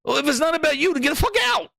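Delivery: high shouted command; angry shout at the chat. Real, not acted. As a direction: yell them out the door. Shouting at fans.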